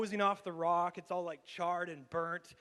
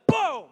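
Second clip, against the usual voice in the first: a sudden shouted boom, much louder and higher; explosion boom; 12 semitones higher, 16 dB louder than usual. Retold or imitated. Telling the church how the batteries blew up, a loud shouted boom. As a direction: burst out with the bang of the exploding toy.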